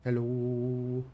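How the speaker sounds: slow, spelled-out alien voice saying hello; mock robot greeting. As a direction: play a machine learning human speak. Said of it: "it's polite in human speak".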